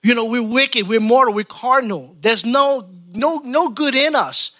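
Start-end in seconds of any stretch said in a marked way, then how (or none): none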